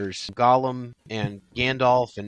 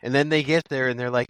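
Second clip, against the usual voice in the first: ordinary talk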